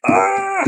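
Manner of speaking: effortful cry at stuck door; short strained shout; strained yelp. Real, not acted. Struggling with jammed door.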